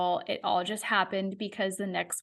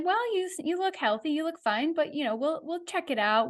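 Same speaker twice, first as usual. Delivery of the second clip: higher-pitched, casual reassurance, lightly acted; lightly voiced reassuring doctor; 6 semitones higher than usual. Doctor telling patient she looks healthy, lightly voiced reassurance. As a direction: imitate a doctor brushing off worry.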